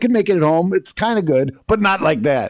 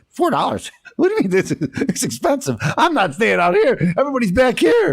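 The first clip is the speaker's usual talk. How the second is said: high-pitched mock outrage; hammed-up indignant complaining; indignant driver; 11 semitones higher than usual. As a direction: balk at the price, voice pitched way up. Acted indignant driver at parking fee.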